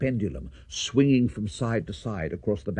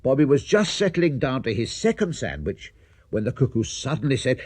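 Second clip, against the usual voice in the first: plain reading voice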